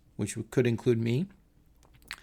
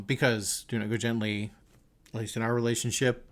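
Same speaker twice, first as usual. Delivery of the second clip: ordinary talk, nothing marked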